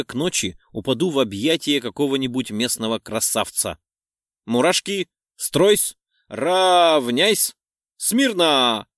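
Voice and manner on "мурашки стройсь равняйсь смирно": barked parade-ground commands, louder and higher; shouting drill sergeant. Acted. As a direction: bark orders at troops on parade. Commanding her goosebumps like troops.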